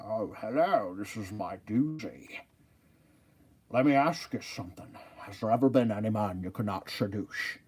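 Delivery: a lightly voiced Scottish Sean Connery; a hint of Scottish Connery charm; Sean Connery impression. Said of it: "like Sean Connery a little bit".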